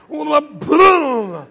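loud exploding boom; crash noise. Acted. Brother landing with complete abandon, loud vocal crash. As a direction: burst out an impact sound. Brother lands on top of him.

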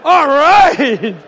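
loud, high whooping encouragement; gleeful cheering. Acted. Kid cheering dad spanking his brother, loud glee. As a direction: egg him on with glee. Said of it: "I start laughing. I'm like".

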